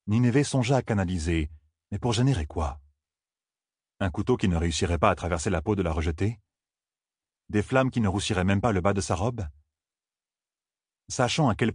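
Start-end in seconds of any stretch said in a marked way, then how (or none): none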